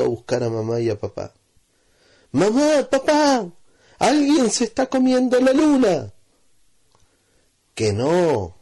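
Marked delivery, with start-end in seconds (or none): 2.3-6.1 put-on far higher, louder child's call; urgent calling. Children run shouting to parents. 7.7-8.6 lightly voiced father. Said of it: "le dijo el papá".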